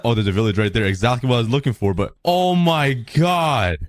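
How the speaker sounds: normal talking voice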